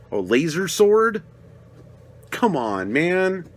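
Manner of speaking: ordinary talk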